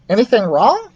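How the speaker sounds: a little high, eager mouse voice; perky small mouse asking; lightly voiced mouse Ralph. Ralph the mouse peeks out to ask Keith, high and eager.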